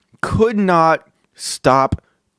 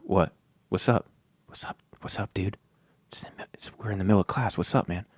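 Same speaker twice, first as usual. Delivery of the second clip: hushed, softer, half breathed; whispered reply; 8 semitones lower, 12 dB quieter, faster than usual. Retold or imitated. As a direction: answer under your breath mid-lesson. Zach whispering back in class.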